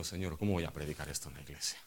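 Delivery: quiet, fast, inward plea; pastor's rushed, hushed aside to God; hushed prayer to God. Retold or imitated. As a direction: murmur it as a private plea to the Lord. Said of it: "Yo digo".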